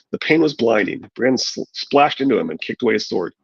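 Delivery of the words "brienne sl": ordinary delivery, nothing marked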